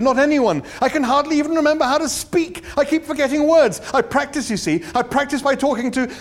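high, loud, flustered rush; emotional outburst. A narrator's reading. Arthur to Ford, desperate to talk again, high and loud. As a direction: pour it out high and loud, hunting for words. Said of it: "in a sudden access of emotion".

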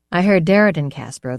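plain reading voice

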